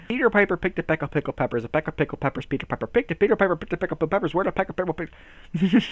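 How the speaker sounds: rattled off at speed; fast tongue twister. Pretend. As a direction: race through it until you trip. Racing through a tongue twister.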